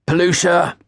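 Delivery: shouted high and loud across the pass; called order. A narrator's reading. The chef Bob calling loudly across the kitchen for the order on thirty-eight. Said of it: "came the cry".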